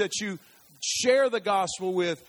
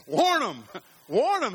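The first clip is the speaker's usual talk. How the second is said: urgent call; 7 semitones higher, 7 dB louder, slower than usual. Real, not acted. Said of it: "urging congregation to warn others".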